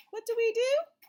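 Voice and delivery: ordinary talk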